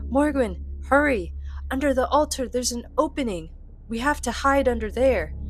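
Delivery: high, loud urgent shout; urgent yell. Acted. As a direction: yell for her to hurry. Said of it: "Celine yells at Morgawin".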